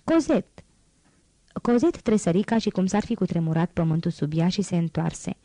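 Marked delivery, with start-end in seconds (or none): 0.0-0.6 sharp, higher angry call of the name; a scold's cry, pitched higher; angry shout. Acted. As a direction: summon the girl in a fury. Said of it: "Strigă cu un glas răgușit de supărare".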